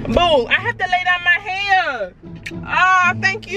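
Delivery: put-on ratchet. Prankster defending her hair wrap, high mock ratchet. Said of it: "Why are you talking like that?".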